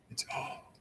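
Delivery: an even gentler whisper; a soft whisper into an ear. Retold or imitated. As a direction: barely breathe the words. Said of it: "wow, it's even gentler".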